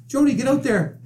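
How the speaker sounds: squeaky high coach voice; high-voiced coach. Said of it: "Dave's got a very high voice".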